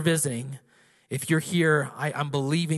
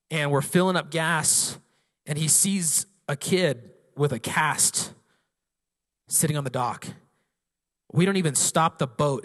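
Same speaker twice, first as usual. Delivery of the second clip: normal talking voice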